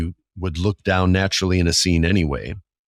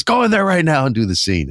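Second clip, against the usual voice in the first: high-pitched, raised-voice coach's command; insistent order; 10 semitones higher, 4 dB louder than usual. Retold or imitated. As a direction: insist hard, imitating a fired-up coach. Coach repeats order after refusal.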